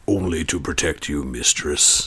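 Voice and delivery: low, soft wolf voice; low growly wolf voice. Acted. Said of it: "I don't know how to do a medium wolf voice".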